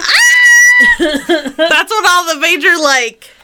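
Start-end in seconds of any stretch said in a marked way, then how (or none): 0.2-0.9 sudden high shriek of laughter; high squeal of a laugh. Real, not acted. Shrieking laugh at serial killer joke.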